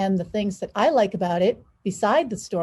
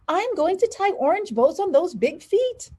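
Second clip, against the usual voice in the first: lightly voiced playful teacher, higher; teacher's voice; 10 semitones higher than usual. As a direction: tease like a fond ballet teacher. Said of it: "she called out".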